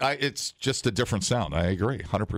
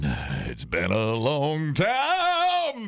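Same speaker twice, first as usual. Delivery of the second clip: breathy vintage purr; 6 semitones higher, slower than usual. Retold or imitated. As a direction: croon it like [name]. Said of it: "[name] was kind of a bedroom voice".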